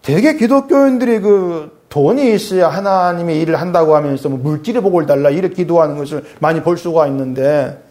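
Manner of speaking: ordinary talk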